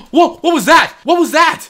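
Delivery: sudden high, loud alarm; startled. Real, not acted. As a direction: jump at a noise. Startled by noise.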